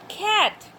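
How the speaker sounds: "cat" said alone, high and crisp for repeating; model word for repeating. Shown as a demonstration. Teacher to class on the tongue's touch, model pronunciation of "cat". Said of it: "listen and repeat".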